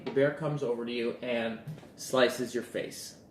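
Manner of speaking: ordinary talk, nothing marked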